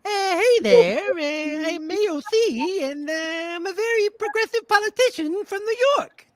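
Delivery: overdone high-voiced AOC parody; AOC impression. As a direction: pitch way up for a hammed-up young congresswoman. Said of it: "I'd like to play A O C".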